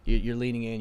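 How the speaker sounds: usual speaking voice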